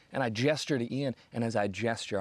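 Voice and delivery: ordinary delivery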